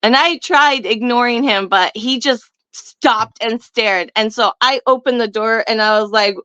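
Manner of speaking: usual speaking voice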